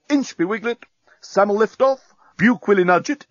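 high, lilting list of names, each lifted at the end; officer's roll call. A narrator's reading. Captain introducing his corporals to Nettie, high roll-call voice. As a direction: rattle off the corporals with ceremonial lift.

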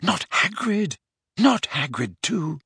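dismayed cry held inside the head; anguished inner voice. A narrator's reading. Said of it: "an unbidden voice in his head said".

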